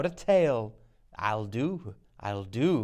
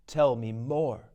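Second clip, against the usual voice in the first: ordinary talk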